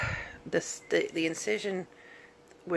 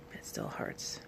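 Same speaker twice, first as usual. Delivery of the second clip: pained whisper; 11 dB quieter, slower than usual. Real, not acted. In pain after surgery.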